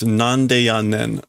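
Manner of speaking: drawn out word by word; slowly spelling out nande yannen; slow sounded-out reading. Shown as a demonstration. Working through the phrase, said slowly. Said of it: "a little bit with".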